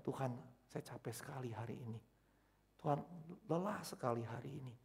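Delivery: soft, worn-out murmur to God; tired prayer. Retold or imitated. Exhausted preacher telling God he is tired, soft weary voice. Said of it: "kalau lagi saya kelelahan".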